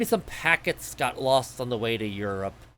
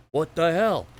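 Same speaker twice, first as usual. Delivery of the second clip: Brooklyn accent; 4 semitones higher, 4 dB louder than usual. Shown as a demonstration. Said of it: "I can talk like I'm from Brooklyn".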